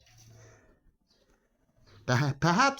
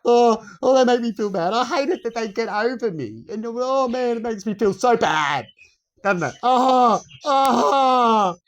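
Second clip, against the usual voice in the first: higher, louder whiny moaning; mock whining; 6 semitones higher, 5 dB louder than usual.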